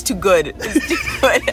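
words broken by laughter; laughing. Real, not acted. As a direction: giggle through it. Laughing over joke.

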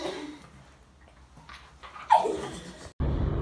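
breathy high giggling; high giggle. Real, not acted. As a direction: break into giggles. Laughing after intro.

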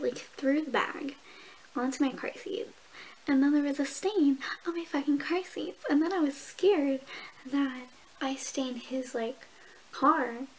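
usual speaking voice

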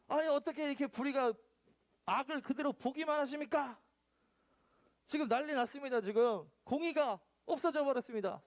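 high, pressing complaint to God; pleading prophet. Acted. Habakkuk complaining to God, high and pressing. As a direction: protest like a prophet crying out.

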